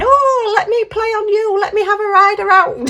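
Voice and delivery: put-on high, childlike pleading voice; playful baby turtle. Baby turtle asking to ride on the others, high childlike. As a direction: beg to play like an eager little one. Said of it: "And this one's saying".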